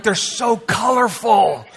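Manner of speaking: put-on high eager child voice, slow; gushing like a kid at candy; kid excitement. Remembering seeing candy as a kid, excited. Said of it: "I remember as a kid".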